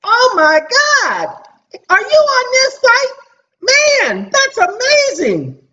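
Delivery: high, slow gushing mock voice; hammed-up amazed admirer; mock excited fan. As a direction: gush like an overexcited fan. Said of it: "And so he says".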